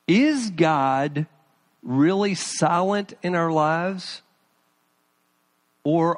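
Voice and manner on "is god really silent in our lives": ordinary talk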